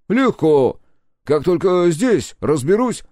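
loud, high reply from afar; high, carrying, offhand answer; calling back. A narrator's reading. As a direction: shout it along the corridor. Answering from down the corridor.

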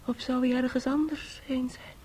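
unvoiced, anxious whisper. Niece whispering her fear to the aunt. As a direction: breathe the question fearfully.

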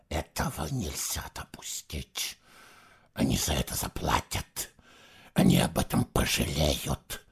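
low, soft incoherent muttering; muttering to himself. Acted. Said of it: "бессвязно бормотал он себе под нос".

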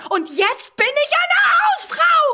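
sehr hoch, laut, gedehnt kreischend; hysterischer Aufschrei. Acted. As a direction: schrill hinausschreien. Hysterischer Ausbruch. (hoher, gedehnter Schrei)

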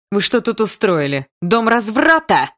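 loud, high scandalised shout; teacher bellowing in outrage; outraged yell. A narrator's reading. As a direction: holler in shock, stretching the words. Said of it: "заорала она".

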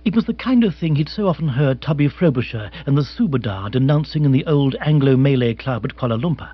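ordinary delivery, nothing marked

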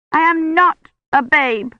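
put-on high, loud indignant little girl; forceful child protest. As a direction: insist fiercely like an offended child. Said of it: "with such force".

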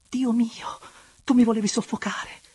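high, loud, airy outcry; high burst after a smothering kiss; breathless protest. Acted. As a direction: gasp out a mock-scolding protest to a lover.

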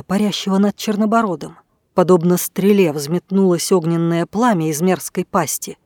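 ordinary delivery, nothing marked

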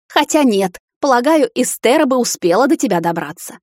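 high, loud bitter remark; bitter anger. A narrator's reading. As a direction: sneer it out. Mother berates son. (горькая злость, выше и громче)

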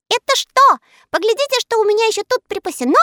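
high, fast boastful elf; smug. Acted. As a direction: show off proudly. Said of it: "сказал самодовольный Скок". (самодовольно)

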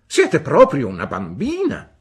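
put-on the aunt scolds the weeping young widow; scolding. A narrator's reading. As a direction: tell her off sharply.

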